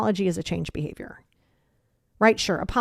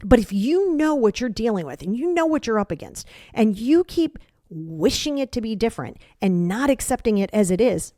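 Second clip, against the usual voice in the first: ordinary talk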